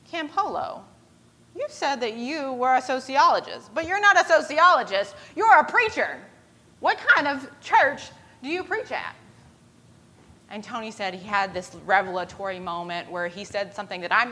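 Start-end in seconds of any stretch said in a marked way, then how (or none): 0.1-9.1 high, emphatic challenge; accusing. Retold or imitated. Harry confronts Tony about being a preacher, accusing. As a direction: confront someone caught in a fib.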